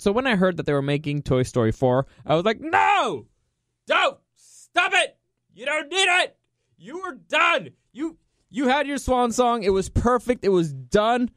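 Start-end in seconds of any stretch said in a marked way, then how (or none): 2.6-11.3 high-pitched mock-desperate pleading; hammed-up outraged protest, voice shooting up; protesting outcry. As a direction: beg them to stop, comically overwrought. Acts out horrified reaction to sequel.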